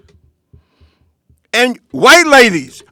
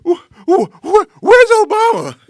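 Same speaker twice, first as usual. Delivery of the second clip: mock panic; 7 semitones higher than usual. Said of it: "and they say".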